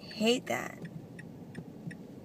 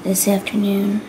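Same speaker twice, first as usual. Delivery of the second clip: whispering; 7 dB louder than usual. Real, not acted. Whispers to camera throughout.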